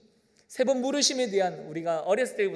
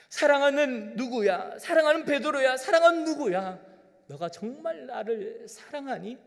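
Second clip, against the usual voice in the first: tender pleading; 6 semitones higher, 6 dB louder than usual. Pretend. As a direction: call the beloved one gently, then soften. Jesus pleading lovingly. (간절하고 다정하게)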